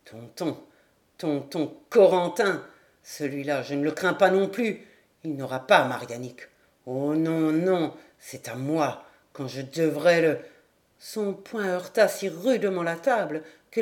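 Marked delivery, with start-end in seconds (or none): 0.0-10.4 put-on low, heavy-lipped drunk; halting, slurred drunkard's muttering; drunken stammering. A narrator's reading. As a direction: stammer as if brandy weighs on your lips. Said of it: "bégayant de ses lèvres lourdes d'eau-de-vie".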